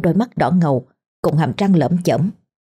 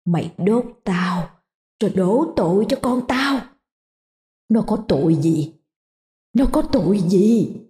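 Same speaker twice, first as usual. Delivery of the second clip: vengeful ghost; 3 semitones higher than usual. Acted. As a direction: hiss out her grievance. Ghost accuses Lành.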